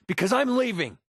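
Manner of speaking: high, emphatic parting shot; angry walkout. Acted. Telling a partner the partnership is over, angry and high. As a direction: declare it hotly, pitch shooting up. Announces walking out after quarrel.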